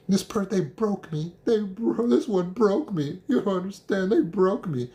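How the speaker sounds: high, whiny wail of being broken; mock broken victim. Mocking the listener's self-pity, high whiny wail. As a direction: whimper it like a self-pitying victim. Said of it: "I also don't want to hear that I'm broken".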